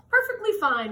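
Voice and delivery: loud, bright perfectly fine; pleased reply. Acted. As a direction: answer cheerfully. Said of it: "replied Mister Curtis".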